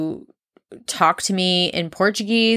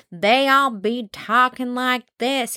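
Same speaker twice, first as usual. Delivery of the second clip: slow, higher-pitched mock Southern drawl; 5 semitones higher, slower than usual. Podcaster mocking how slow the South talks, Southern drawl. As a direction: stretch it out in a lazy Southern accent. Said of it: "If he moved down south".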